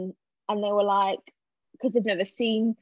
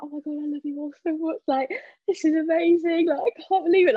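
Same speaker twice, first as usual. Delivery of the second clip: tearful gushing; 8 semitones higher, 5 dB louder than usual. Retold or imitated. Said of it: "Like, I was crying".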